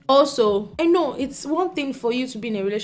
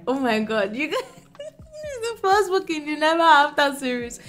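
excited laugh, pitched up; 6 semitones higher than usual. Real, not acted. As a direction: giggle while introducing the book. Excited about the next book.

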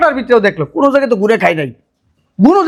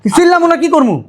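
high-pitched indignant retort; worked-up, raised-voice protest; yelling back; 7 semitones higher than usual. Acted. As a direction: fire back with the pitch jumping up. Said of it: "তুমি সব সময় চিল্লাও কি".

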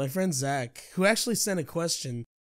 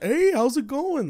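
put-on older lady greeting; 11 semitones higher, 6 dB louder than usual. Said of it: "She's like".

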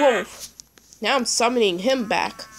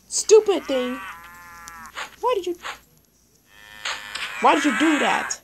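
annoyed outburst; 4 semitones higher than usual. Real, not acted. Scolding the animal that spoiled it, angry yell. Animal ruins his plan.

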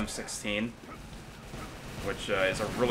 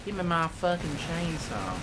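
slow whine; 6 semitones higher than usual. Pretend. Slow whiny demand.

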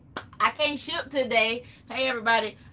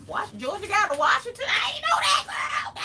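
surprised exclamation; 12 semitones higher than usual. Real, not acted. Reacting to a customer's hometown, high surprised shout. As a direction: squeal in surprise at the news.

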